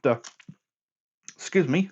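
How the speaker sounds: talking through hiccups; hiccuping. Real, not acted. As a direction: hiccup breaking into the words. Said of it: "as I keep hiccuping and burping".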